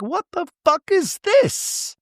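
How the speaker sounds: very high, slow imitation shriek; hammed-up high-pitched disbelief; mock outrage. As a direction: squeal it out, stretched and incredulous.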